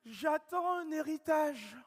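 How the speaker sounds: high, slow, drawn-out mock quote with a laugh; mock prospect. Mimicking a prospect announcing an inheritance, high and slow. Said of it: "il m'a dit".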